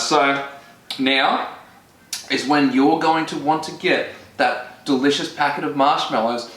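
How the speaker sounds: ordinary talk, nothing marked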